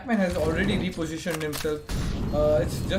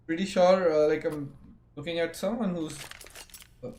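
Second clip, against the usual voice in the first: ordinary delivery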